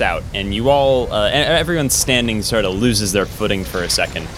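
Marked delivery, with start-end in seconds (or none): none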